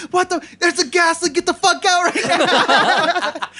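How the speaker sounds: high, loud alarmed shout, slowing at the end; mock panic. Everybody in the room fleeing a gas leak, loud and panicked. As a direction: yell the warning like the room is on fire.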